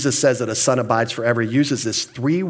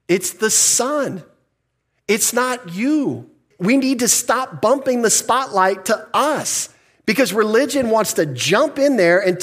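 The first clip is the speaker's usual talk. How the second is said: much higher and slow, building to the point; rising preaching; 9 semitones higher, slower than usual. Real, not acted. Preacher urging the church, higher and slower. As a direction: lift the voice high and lean on each word.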